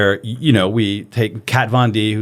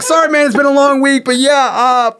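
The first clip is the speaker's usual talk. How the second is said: much higher, laughing while apologizing; real laughter breaking through an apology; laughing through words; 13 semitones higher, 5 dB louder than usual. Real, not acted. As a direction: try to talk but the giggles win. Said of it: "I just couldn't keep a straight face".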